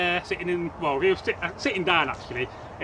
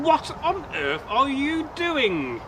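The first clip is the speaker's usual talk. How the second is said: higher, slow disbelief; baffled; 7 semitones higher, slower than usual. Acted. Young man asks the old man pushing a cow. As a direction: drag out the amazement.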